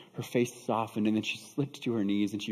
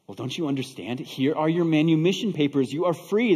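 emphatic, voice lifted to convince; insistent, full-voiced explaining; urgent insistence; 5 semitones higher, 4 dB louder than usual. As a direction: press the point so it gets through. Buyer repeats it, urging her to understand.

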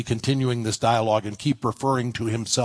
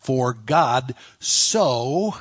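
slow build to a long, rising "so"; "so" drawn out with an upward lift; stress on SO; much slower than usual. Shown as a demonstration. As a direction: stretch "so" out and leave it hanging. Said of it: "put the emphasis of the verse".

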